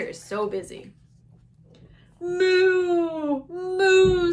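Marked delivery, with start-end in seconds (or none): 2.2-4.3 long, high, loud moos; cow mooing. Acted. Teacher voicing the cow for children, loud drawn-out mooing. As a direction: draw out each moo like a cow. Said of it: "said the cow".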